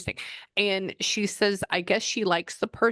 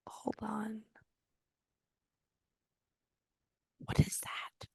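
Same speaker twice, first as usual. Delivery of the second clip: very soft, breath-only murmur; hushed, puzzled aside; puzzled whisper; 4 semitones higher, 9 dB quieter, slower than usual. Real, not acted. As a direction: whisper to yourself while concentrating. Sensing something, talking to self.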